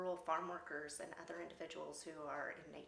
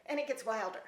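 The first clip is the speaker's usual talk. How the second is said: usual speaking voice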